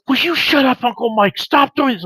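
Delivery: high-pitched, exasperated nephew imitation; mortified pleading. Embarrassed nephew telling off his uncle, mortified pleading. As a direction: beg him to stop, voice pitched right up.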